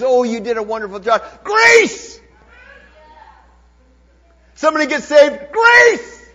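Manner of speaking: high, loud, ringing preacher's cry; full-force preaching. Acted. Pastor urging the congregation to thank God, loud fervent preaching. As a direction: preach it out to the rafters with laughing joy.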